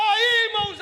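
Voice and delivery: loud, breaking cry to the brothers; tearful cry. Real, not acted. Testifying to the church, crying out loudly. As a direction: let the emotion burst out.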